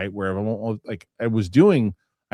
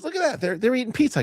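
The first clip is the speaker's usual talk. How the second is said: much higher voice imitating the daughter; squeaky mock teen delight; daughter spotting neighbors. Father imitating daughter spotting the neighbors, high squeak. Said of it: "She goes".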